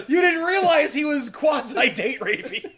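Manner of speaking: loud, high, laughing outcry; hammed-up appalled yell; mock horror. Trackers scolding the woman in a joke scene, mock horror. Imagined trackers, exaggerated alarm.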